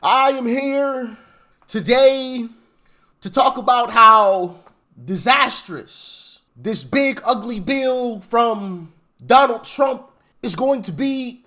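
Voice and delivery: mock a politician, pausing after every phrase; Jeffries Obama impression. Mocking Jeffries' speech, slow loud pompous pauses. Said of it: "He does the pregnant pause after everything".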